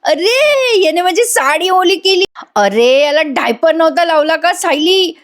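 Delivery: hammed-up wail over a wet sari; retold loud outcry. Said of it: "ती मोठ्याने ओरडायला लागते".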